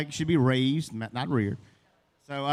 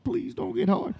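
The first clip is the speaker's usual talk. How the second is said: Please jumps up high, begging; panicked high-pitched pleading; desperate high plea. Acted. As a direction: beg in a strained high voice. Pleading to himself.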